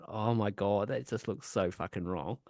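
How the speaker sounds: low, soft muttered disbelief; quiet, dropping away as if to oneself; hushed aside. Retold or imitated. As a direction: reenact a comment under the breath. Retelling a private amused horrified aside.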